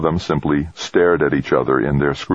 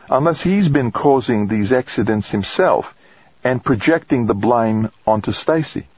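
higher woman's voice, tentative theory; woman colleague; 7 semitones higher than usual. Acted. Colleague suggesting he caused the accidents, speculating. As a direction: voice a woman airing a suspicion, pitched up.

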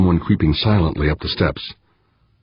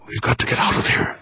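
urgent whisper, higher; hissed whisper; 6 semitones higher, 4 dB quieter than usual. A narrator's reading. Sanja urging Fuchs to flee, hissed whisper. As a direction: hiss it right into his ear. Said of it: "Sanja hissed in his ear".

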